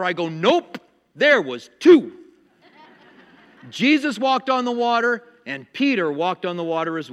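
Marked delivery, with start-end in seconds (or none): 0.3-2.1 loud, emphatic mock correction; talking back to TV. Preacher correcting the movie on TV, loud contradiction. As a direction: call it out at the screen, punching the last word. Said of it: "I talked to the T V".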